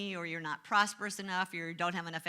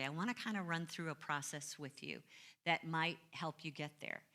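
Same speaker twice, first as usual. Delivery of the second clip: ordinary talk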